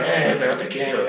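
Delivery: low, gruff mock affection; gruff macho tenderness. Tough father hugging too hard, gruff low mock love. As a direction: say I love you like a rough tough guy. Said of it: "Te da un abrazo y te lastima".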